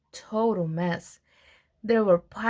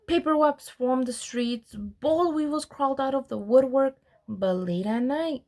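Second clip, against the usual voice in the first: ordinary delivery, nothing marked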